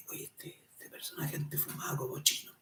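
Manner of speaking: hushed, whispery gossip; acted whisper between curious onlookers; gossiping aside. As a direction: murmur it behind someone's back. Onlookers gossiping about a man.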